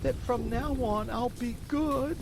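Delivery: high, slow tearful promise; high and slow, choked with tears; sobbing. Acted. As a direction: sob the promise out like a sorry boy.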